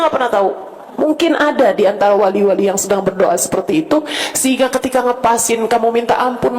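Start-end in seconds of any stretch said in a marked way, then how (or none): none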